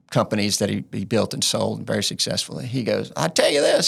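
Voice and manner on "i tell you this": put-on Cajun accent. Quoting an old Cajun businessman in a mock Cajun accent. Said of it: "an old Cajun guy".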